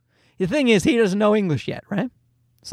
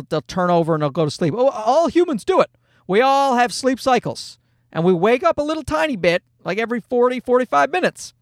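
usual speaking voice

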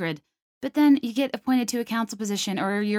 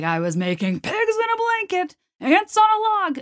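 higher, hammed-up fake fury over silly food; mock angry rant; 12 semitones higher, 4 dB louder than usual. Host pretending to rage about party food, mock angry. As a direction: pitch it up and rant like it's a grave offense. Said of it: "every time I'm angry".

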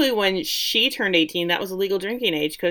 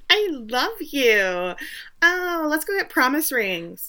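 slow, higher, sugary drawl; mock gushing; 7 semitones higher, slower than usual. [name] mocking Eric's love confession, slow and higher. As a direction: overdo the sweetness, slowly.

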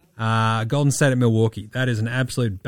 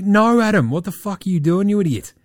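higher, louder mock rant at the boss; exasperated telling-off; 7 semitones higher, 5 dB louder than usual. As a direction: let rip at the commissioner. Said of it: "I'll be like".

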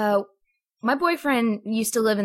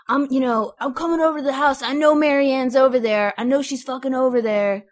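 raised, louder imitation of an angry caller; mock threatening caller; 7 semitones higher, 7 dB louder than usual. Retelling a stranger's threat to the roommate, mock angry. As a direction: act out the phone threat, higher and forceful.

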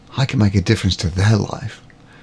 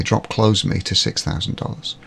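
ordinary delivery

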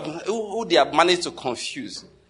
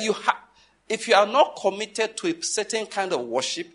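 ordinary talk

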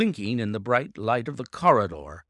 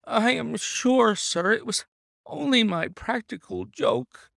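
whimpering; 9 semitones higher than usual. A narrator's reading. Oldacre begging the detective, high whimpering plea. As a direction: plead weakly like a cornered creature. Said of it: "began to whimper".

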